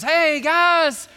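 high, loud hammed-up hello; over-eager mock greeting, sung out high; hearty greeting. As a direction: hail the new arrivals with big show.

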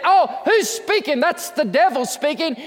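usual speaking voice

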